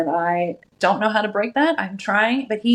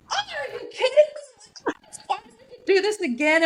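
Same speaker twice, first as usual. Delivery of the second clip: mock husband; 13 semitones higher than usual. Husband balks at another birth, in a high mock-outraged voice. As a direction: ham up the horrified husband. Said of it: "And my husband's like".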